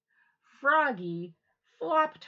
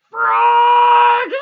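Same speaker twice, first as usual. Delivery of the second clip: very loud high call; 13 semitones higher, 22 dB louder than usual. Acted. Mother calling Froggy back, very loud. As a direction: call across the yard. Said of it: "called his mother".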